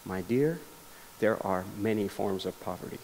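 soft, low, gentle and unhurried; gentle Mother Teresa. Retold or imitated. Mother Teresa gently answering the angry volunteer, soft and low. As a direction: speak kindly to the upset volunteer. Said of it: "She says".

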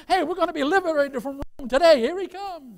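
high, eager cheer of anticipation; excited crowd member. Acted. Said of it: "they're thinking".

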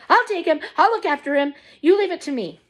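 high and loud, insistent; eager. A narrator's reading. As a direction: push hard to be chosen. Grandpa Joe eager to go.

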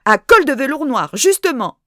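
loud, high, triumphant exclaim; triumphant cry. A narrator's reading. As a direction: crow it out in triumph. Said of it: "s'écria madame Renaud triomphante".

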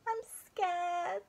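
high small voice of a scared cub; high-pitched timid bear cub; little bear cub. A narrator's reading.

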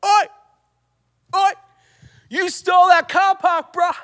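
loud high yelling from a car; mock shout. The car full of people shouting at them, loud mock yell. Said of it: "this car full of people go".

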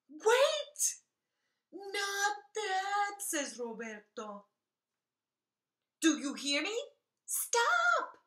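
high frantic pleading yell; panicked shout. Acted. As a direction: scream at it to stop. Roberto begging monster to stop.

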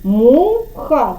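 "Муха" split into "Му" and "ха"; syllable by syllable. Shown as a demonstration. Teacher counting syllables with a child, "Муха" split in two. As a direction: say "Муха" with a gap between syllables. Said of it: "Скільки складів у цьому слові?".

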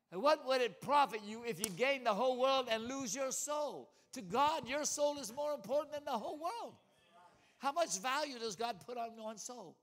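high, loud revival preaching; preaching at full force. Acted. Preacher firing up the congregation about the Most High. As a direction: lift the whole church with it.

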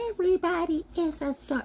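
high mocking singsong slogan; mock singsong quote. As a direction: chirp it like a naive cliché. Quoting a cheesy slogan.

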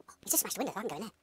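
hushed sneaky whisper; soft breathy aside. Real, not acted. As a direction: whisper so the neighbour won't hear. Sneaking in while hiding.

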